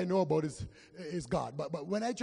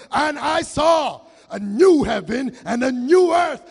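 full-force shout, higher and louder; shouted proclamation; 7 semitones higher, 14 dB louder than usual. Real, not acted. Preacher showing the church how believers read, shouting. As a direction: read it like you believe every word. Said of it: "I believe, so I will read for you".

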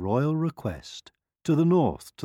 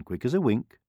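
usual speaking voice

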